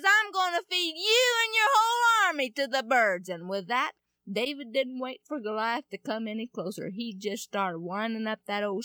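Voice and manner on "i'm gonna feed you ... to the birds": loud, high threatening roar; hammed-up furious giant; mad giant. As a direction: bellow a threat at David. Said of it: "he's so mad".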